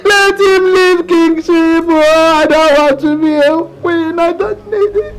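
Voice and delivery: crying, begging through tears; mother weeping over her only son; sobbing plea. Acted. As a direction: sob out each refusal of the crown. Mother sobbing, begging against crown.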